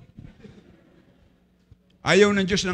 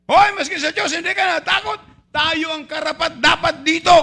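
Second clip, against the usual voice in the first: much higher, loud scolding yell; rebuking shout; 9 semitones higher, 5 dB louder than usual. Acted. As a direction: snap at the other thief. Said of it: "si Kristo ay Diyos".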